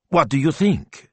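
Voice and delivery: eager, bright question; excited. Acted. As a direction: pitch it up with excitement. Betta excited about idea.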